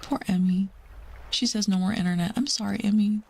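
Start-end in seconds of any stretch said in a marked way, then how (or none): none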